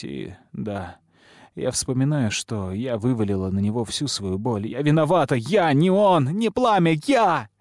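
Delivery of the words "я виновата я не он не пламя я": high, anguished confession cry; desperate self-blaming outburst; breaking into sobs. A narrator's reading. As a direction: cry out the guilt, climbing higher. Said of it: "И она зарыдала".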